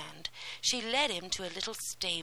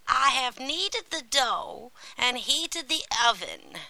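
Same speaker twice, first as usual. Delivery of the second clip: raised, louder witch voice; old witch; 5 semitones higher, 6 dB louder than usual. A narrator's reading. Witch lures Gretel towards the oven, commanding. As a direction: give scheming orders as the witch. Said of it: "then the witch said".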